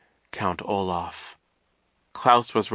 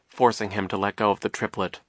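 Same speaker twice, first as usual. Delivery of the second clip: ordinary delivery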